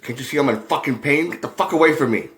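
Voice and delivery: loud, high, cursing blast at a fan; furious yell. Retold or imitated. Injured star yelling at the kid for an autograph, furious shout. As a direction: bark him away in pain and rage. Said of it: "He just yelled at him".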